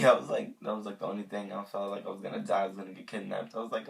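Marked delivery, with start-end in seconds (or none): none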